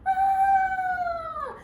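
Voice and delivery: very high squealing cry; pig squeal. A narrator's reading. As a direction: shriek like a frightened pig.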